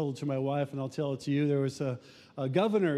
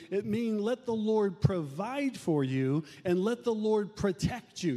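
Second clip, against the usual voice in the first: ordinary talk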